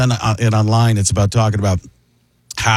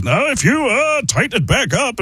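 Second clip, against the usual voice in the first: higher, tight Rogen voice; raspy stoner laugh voice; Seth Rogen; 10 semitones higher than usual. Acted. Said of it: "it becomes Seth Rogen".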